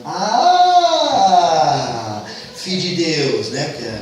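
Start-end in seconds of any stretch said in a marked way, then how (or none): 0.0-2.2 long, loud drawn-out "ah"; exasperated wail. Acted. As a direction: let out a stretched, outraged groan. Eve's drawn-out exasperated cry.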